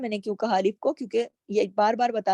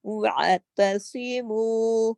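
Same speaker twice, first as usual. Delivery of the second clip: slow, drawn-out tajweed recitation; Quran recitation; much slower than usual. Shown as a demonstration. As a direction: recite it slowly with full madd. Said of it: "اس کے ساتھ بھی واو مدہ ہے". (تلاوت کے انداز میں آہستہ)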